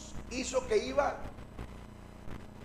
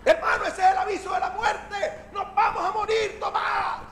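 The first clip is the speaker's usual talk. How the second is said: high, loud, hammed-up alarm; mock-hysterical warning, pitched way up; panicked yelling; 12 semitones higher, 6 dB louder than usual. Disciple warns Tomás that death is coming, hammed-up panicked yelling.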